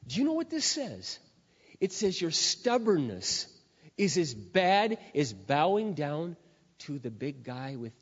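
loud, slow, emphatic pulpit voice; preacher hammering home a warning to the church, preaching at full force; fiery preaching. Acted. Pastor warning the congregation against stubbornness, fiery preaching.

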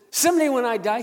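loud, high mock boast; imitating boastful coworker. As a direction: holler it out as the rough mechanic. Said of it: "this is what he did".

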